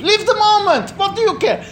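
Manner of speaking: high, coaxing voice of temptation; mock tempter urging indulgence; tempting inner voice. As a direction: egg yourself on, up high.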